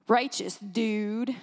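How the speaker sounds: slow, low California dude voice; attempted California accent. Preacher joking on the word righteous, attempted California accent. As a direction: try a drawn-out California surfer-dude accent. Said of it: "do that California accent".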